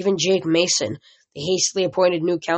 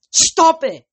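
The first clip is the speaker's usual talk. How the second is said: sharp yell, much higher and louder; furious shout to stop the quarrel; 12 semitones higher, 8 dB louder than usual. A narrator's reading. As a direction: cut the argument dead with a shout. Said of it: "I yelled".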